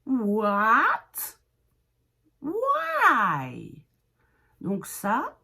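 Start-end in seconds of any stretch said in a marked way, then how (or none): 0.1-1.4 «what» loud, with a sweeping rise; caricatured English intonation. Shown as a demonstration. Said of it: "je caricature un peu". 2.4-3.9 «Why» loud, high, long swoop up and down; rising then falling. Shown as a demonstration. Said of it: "on monte et on descend".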